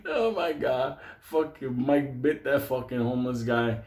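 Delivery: ordinary talk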